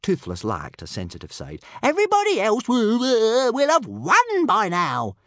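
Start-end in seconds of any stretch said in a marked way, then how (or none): 1.8-5.2 loud, high stuttering complaint; indignant bawl. A narrator's reading. Little dragon afraid of losing the race, indignant bawl. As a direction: bawl it out in a squawking creature voice. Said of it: "bawled Toothless indignantly".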